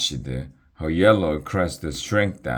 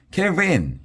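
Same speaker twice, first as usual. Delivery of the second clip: high alarmed yell of warning; 13 semitones higher than usual. A narrator's reading. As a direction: shout it as the cave gives way.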